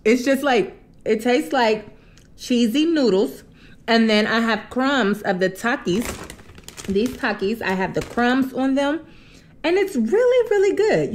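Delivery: slight chewing while talking; between bites of food. Real, not acted. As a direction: mouth partly full. Seen 0: Holds forkful at mouth, talks between bites throughout mukbang.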